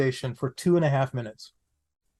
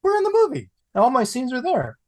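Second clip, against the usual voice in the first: much higher, thrilled exclamation; excited relief; 10 semitones higher than usual. Retold or imitated. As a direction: burst out with joy. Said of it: "I'm going".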